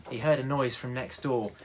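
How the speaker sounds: plain reading voice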